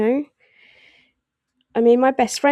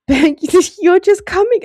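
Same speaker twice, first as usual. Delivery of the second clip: laughing out loud, high and loud; real laughter breaking into the words; 7 semitones higher, 8 dB louder than usual. Real, not acted. Reader cracking up at a Capricorn's drama, laughing. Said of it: "I'm laughing because".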